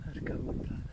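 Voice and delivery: whispered curse of awe; awed whisper. Real, not acted. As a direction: breathe it out stunned. Awed at the view.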